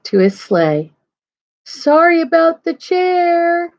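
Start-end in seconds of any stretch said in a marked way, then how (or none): 1.7-3.7 high, slow, drawn-out call. A narrator's reading. Santa calls to the bears from his sleigh, drawn-out call. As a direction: call back from afar, stretching the words. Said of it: "Santa called".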